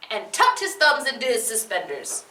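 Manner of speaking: ordinary delivery, nothing marked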